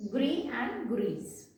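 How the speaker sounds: "Gris and grise" said slowly, setting the silent s against the sounded z; slow teacher's contrast of "Gris" and "grise". Shown as a demonstration. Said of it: "the pronunciation is changed".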